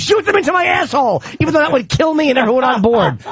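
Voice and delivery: high, shouted mock eagerness; hammed-up thrilled demand, easing slightly; George Takei. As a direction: yell it like an over-eager volunteer. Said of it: "George Takei goes".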